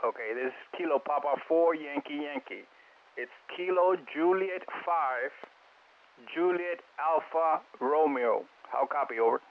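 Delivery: relayed over a radio link; distant radio transmission voice; crackly military radio. Real, not acted.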